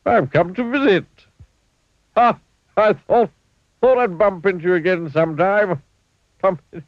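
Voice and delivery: a giggly clumsy visitor, chuckling at his own joke; cheery Mister Bump laughing at his bump pun. A narrator's reading. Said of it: "smiled Mister Bump".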